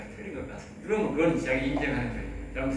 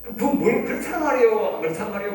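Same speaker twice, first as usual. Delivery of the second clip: mock exasperated; 7 semitones higher, 7 dB louder than usual. Mocking the vague speaker.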